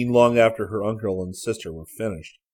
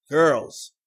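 louder, raised call through a door; 7 semitones higher, 7 dB louder than usual. A narrator's reading. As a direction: call to the girls from outside. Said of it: "voice called from outside the door".

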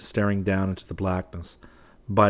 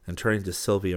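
ordinary delivery

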